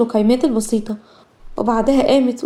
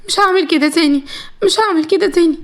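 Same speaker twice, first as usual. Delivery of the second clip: higher-pitched, anxious promise; frightened pleading; 6 semitones higher than usual. A narrator's reading. Threatened captive promising her captor obedience, frightened and pleading. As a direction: beg for mercy in a raised pitch.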